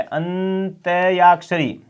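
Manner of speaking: अंत्याक्षरी drawn out slowly syllable by syllable; slow spelled word. Shown as a demonstration. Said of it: "अंत्याक्षरी बनता है". (अंत्याक्षरी को अक्षर-अक्षर धीरे बोलना)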